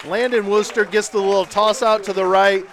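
loud touchdown call, stretched out at the score; excited play-by-play. Real, not acted.

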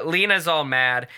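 ordinary talk

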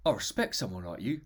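lightly voiced film hard man; Craig Fairbrass. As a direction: loose impression, a touch low and quiet. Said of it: "That's not a Craig Fairbrass impression".

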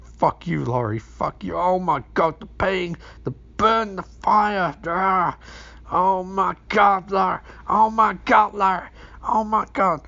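high, loud hammed-up pain; over-the-top dying monster cries; mock agonized Michael. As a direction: wail like a burning villain. Michael burning, cursing Laurie.

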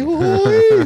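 high, loud loony shriek; Silvano. Acted. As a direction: yelp it like a village madman. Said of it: "ele fica com a mãozinha curtinha assim". (berro agudo de louco)

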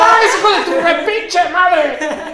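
high, loud, laughing mock rant; mock indignant refusal. Man offered a mint, mock offended and laughing. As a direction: ham up the offended friend.

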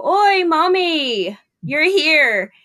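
put-on high, slow, delighted old man greeting; chipper old patient. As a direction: call out happily like an elderly patient glad to see his nurse. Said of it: "real chipper attitude".

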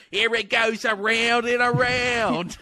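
high, drawn-out Aussie call; mock Australian accent. Joking about holding a boomerang, high mock Australian. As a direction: sing out a silly Australian accent. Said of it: "Yeah, boomerang".

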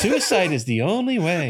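high, loud chipper imitation; hammed-up sweetness with a laugh in it; over-friendly. Cheerful ghost couple offering grim advice, over-friendly. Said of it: "they're so friendly".

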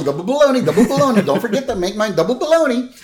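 high, insistent goofy voice; excited, pushy food reminder; Homer. Retold or imitated. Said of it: "Homer's still".

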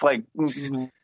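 ordinary talk, nothing marked

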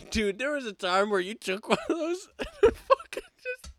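laughing so hard it squeaks; crying with laughter. Real, not acted. Laughing telling spicy story.

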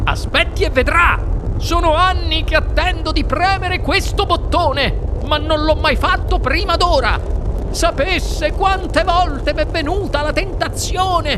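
high, thrilled eccentric inventor; gleeful, stretched-out anticipation; excited exclamation. A narrator's reading. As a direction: build in high-pitched glee to the big moment. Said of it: "esclamò il signor Wonka".

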